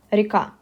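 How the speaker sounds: "река" stressed on the final KA; stress on last syllable. Shown as a demonstration. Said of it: "the stress falls on the last part of the word".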